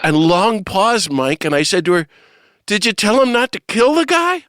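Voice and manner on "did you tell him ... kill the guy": high, loud indignant question; raised voice, mock-angry retelling; retold mad outburst. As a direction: demand it, reenacting your exasperation. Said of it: "I got mad at Mother".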